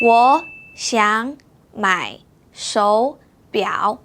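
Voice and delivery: 我想买手表 slow with sandhi; very slow model. Shown as a demonstration. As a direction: stretch each tone clearly. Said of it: "now it's your turn".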